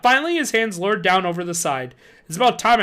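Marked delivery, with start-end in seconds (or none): 2.3-2.8 louder, higher angry shout. A narrator's reading. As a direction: shout it angrily. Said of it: "I shouted angrily".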